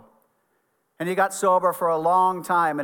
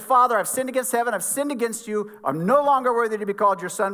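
raised, higher-pitched reading of a penitent son; urgent confession; 6 semitones higher, 3 dB louder than usual. A narrator's reading. Son confessing to the father who embraced him, higher and pressing.

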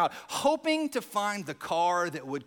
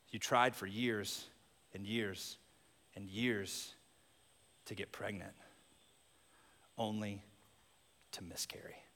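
soft reflective preaching; 11 semitones lower, 8 dB quieter than usual. Acted. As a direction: speak gently into each loss. Soft reflective sermon passage.

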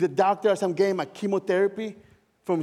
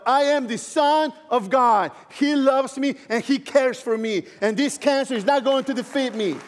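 bold declaration; 6 semitones higher, 3 dB louder than usual. Retold or imitated. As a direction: proclaim it like a vow in the shower. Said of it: "saying to myself".

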